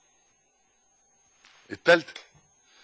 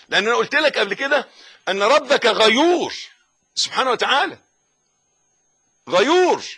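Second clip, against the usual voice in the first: forceful preaching; 7 semitones higher, 7 dB louder than usual. Acted. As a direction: proclaim each phrase to the congregation.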